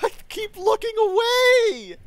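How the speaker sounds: very high, loud anguished yell; agonized gamer wail; wailing shout. Real, not acted. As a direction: cry it out in despair. Wailing at own mistake.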